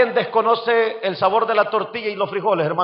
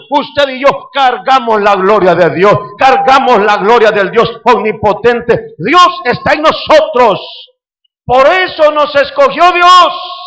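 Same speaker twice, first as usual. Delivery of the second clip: loud, rising proclamation; preacher at full volume; fervent preaching; 6 dB louder, slower than usual. Acted. Preaching climax louder.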